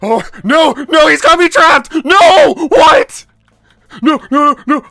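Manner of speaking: very high, loud desperate yells; panicked shouting. Real, not acted. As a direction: shout as the boss traps you. Trapped by boss, losing.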